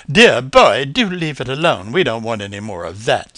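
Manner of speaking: loud, prim lady bird; prim scolding. A narrator's reading. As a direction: tell the boy off primly. Marigold scolding the squirrel.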